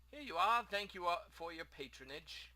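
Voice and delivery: grateful shopkeeper. Acted. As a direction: hand over goods as a cheery merchant. Plays the shopkeeper.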